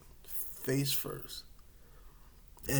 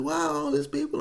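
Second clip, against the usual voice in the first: high loud bewildered question; confused high shout; 14 semitones higher, 5 dB louder than usual. Retold or imitated. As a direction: wake up lost and demand answers. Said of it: "And I'm like".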